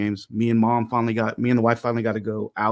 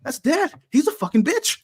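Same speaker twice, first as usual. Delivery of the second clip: put-on very high little-boy voice; squeaky-high mock kid outburst; mad youngest son; 12 semitones higher than usual. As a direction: imitate an indignant eight-year-old. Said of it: "he was the most mad at me".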